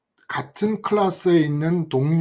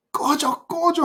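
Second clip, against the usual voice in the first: higher, mock yelled get-lost; a friend shooing the gang away, higher and mock yelled; mock shout; 10 semitones higher, slower than usual. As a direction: chase the gang off with a high call. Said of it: "그 친구가 소리를 지르면서 오더니".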